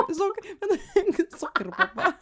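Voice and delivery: laughing. Real, not acted. Laughing at the word.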